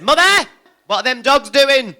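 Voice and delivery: put-on high, loud little boy; child's voice. Little son asks his mother about the dogs: high, loud child voice. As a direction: squeak it out like a curious small boy.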